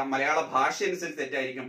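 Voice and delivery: normal talking voice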